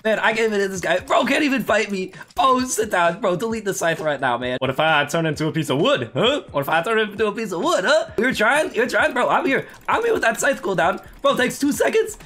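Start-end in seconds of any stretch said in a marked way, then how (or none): none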